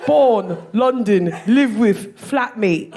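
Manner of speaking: broad put-on London accent; mock London accent, each item announced; guessed London accent. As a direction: read it like a London lad's dating profile. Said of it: "guess what his accent is".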